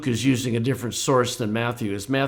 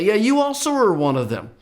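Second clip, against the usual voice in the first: higher, pushy echo of the charge; agreeing accuser; 7 semitones higher than usual. Pretend. Second bystander backing up the first, high insistent accusation. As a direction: pile on the accusation.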